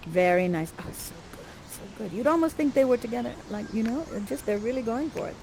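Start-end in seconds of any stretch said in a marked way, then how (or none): 0.8-2.1 breathless whisper of admiration; whispered delight. Real, not acted. Narrator admiring the actors, whispered. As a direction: hush it, moved by the scene.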